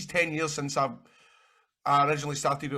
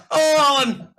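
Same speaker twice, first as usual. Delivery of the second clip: louder, higher hammed Coronation Street accent; 13 semitones higher, 11 dB louder, slower than usual. Mimicking actresses at the audition, even bigger Coronation Street accent. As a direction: overdo the Coronation Street accent calling Alan. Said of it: "they had, like, Coronation Street accents".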